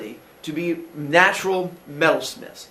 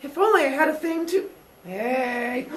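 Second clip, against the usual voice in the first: put-on much higher, goofy boyish glee; playful boy brothers; 11 semitones higher than usual. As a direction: play a kid who just found a sword. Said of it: "they're like".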